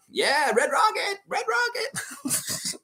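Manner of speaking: very high, slow cartoon-kid voice; stretched-out mock Cartman squeal; Cartman impression. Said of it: "I shouldn't say it like Cartman".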